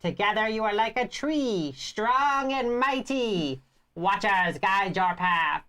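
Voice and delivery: high, slow elder woman speech; old lady voice. Acted. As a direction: proclaim it like a wise old matriarch. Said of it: "I like your voice that you're using for the, like, old lady voice".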